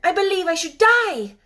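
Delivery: high, loud, fierce outburst. A narrator's reading. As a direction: blurt it fiercely, like a desperate girl. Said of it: "said the last sentence quite fiercely".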